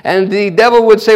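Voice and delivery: ordinary talk, nothing marked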